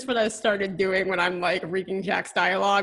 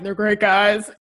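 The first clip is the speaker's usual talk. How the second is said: louder hockey-player catchphrase; teasing jock imitation, pushed louder; mock hockey player; 5 dB louder than usual. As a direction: lightly parody a hockey player at a press conference. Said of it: "right off the bat was just like".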